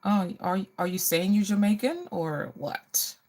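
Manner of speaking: ordinary delivery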